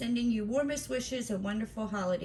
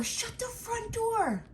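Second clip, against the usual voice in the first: surprised; slower than usual. Real, not acted. Surprise at gift.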